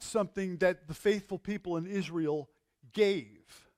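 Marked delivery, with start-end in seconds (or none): 2.9-3.7 gave leaned on, a touch louder; stress on gave. Shown as a demonstration. Preacher stressing gave to the congregation, leaning on it. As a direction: hit the word gave so it stands out. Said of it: "emphasizing the word".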